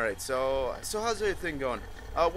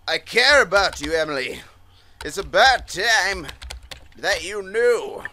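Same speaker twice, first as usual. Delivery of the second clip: louder, slowing melodramatic declaration; hammed-up confession; 3 semitones higher, 7 dB louder than usual. Player confessing to Emily in the game, hammed-up declaration. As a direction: declare it boldly, drawing out the last phrases. Repeats the line as dramatic confession.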